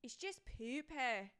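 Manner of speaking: put-on slow, drawn-out northern English accent; exaggerated mock British regional accent; Jamie Tart. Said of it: "like, Jamie Tart".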